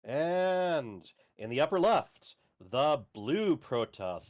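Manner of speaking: slow, drawn-out announcer intro; fighting game announcer. Shown as a demonstration. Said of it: "announcer voice going".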